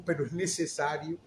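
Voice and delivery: soft, low and tender; hushed reassurance; gentle reply. Retold or imitated. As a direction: answer like a comforting Christ. Jesus answers gently.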